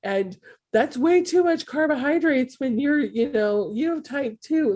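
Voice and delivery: high-pitched, exaggerated panic as you mimic alarmed critics; mock freak-out. People reacting to her going vegan, mock freak-out. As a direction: imitate people freaking out, voice shooting up. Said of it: "everybody freaked out".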